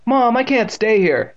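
slow, high plea; desperate. A narrator's reading. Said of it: "Evan turned desperately to his mother".

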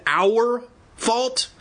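very high, rising incredulous question; sarcastic cry. A narrator's reading. Boy rejecting his friend's blame, high sarcastic disbelief. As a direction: squeak it back in disbelief. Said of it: "She ignored his sarcasm".

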